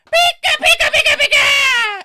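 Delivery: high, loud Pikachu squeal; squeaky Pikachu voice. Retold or imitated. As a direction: shriek it like Pikachu. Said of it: "he talks like this".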